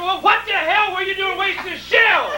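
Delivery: high, shouted scolding; mock an outraged father hollering; exasperated dad. Dad scolds Bill for wasting ammunition, exasperated shouting.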